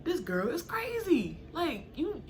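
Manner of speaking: high, slowed disbelief, half-mocking; high-pitched retold "this girl is crazy" astonishment; incredulous disbelief. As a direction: stretch it out, unable to believe her. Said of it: "And I'm like".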